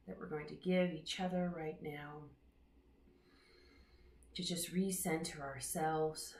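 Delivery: unhurried meditation voice with long pauses; guided meditation. Real, not acted. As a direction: guide them gently into their breath, leaving space between phrases. Leads a breathing meditation.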